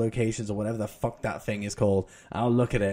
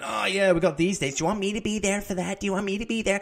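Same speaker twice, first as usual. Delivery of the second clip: put-on high-pitched American girl accent; 7 semitones higher than usual. Wife barging into the kitchen with dates, high American girl accent. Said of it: "really good American Girl voice".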